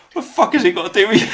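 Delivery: loud, fast mock rant, breaking into laughter; retold angry trucker. Retelling a trucker snapping at dad, loud laughing mock rant. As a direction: bark it back while laughing. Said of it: "And the guy goes".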